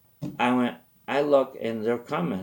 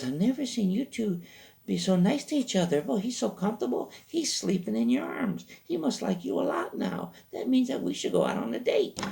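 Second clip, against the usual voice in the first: put-on high, sweet girlfriend voice; high girl voice; 12 semitones higher than usual. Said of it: "She goes".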